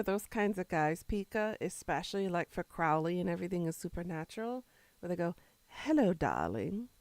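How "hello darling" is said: slow, drawn-out British hello darling; British charmer. Retold or imitated. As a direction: croon it like a charming British demon. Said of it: "the way they say it, the way they breathe it".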